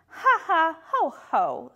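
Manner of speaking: spoken ha-ha, high then dropping; mock-hearty knightly laugh; scoffing laugh. A narrator's reading. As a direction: laugh it off word by word. Small knight scoffs in rhyme.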